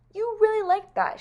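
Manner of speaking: high, delighted cooing; pleased. Acted. Amelia Bedelia to baby Missy after supper, pleased.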